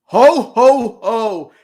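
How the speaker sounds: big booming Santa laugh; jolly ho ho ho. Acted. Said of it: "you're already being festive right now".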